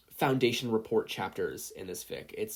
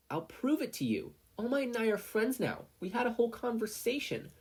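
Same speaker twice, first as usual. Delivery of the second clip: put-on retorting child; 12 semitones higher than usual. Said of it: "Izuku retorted".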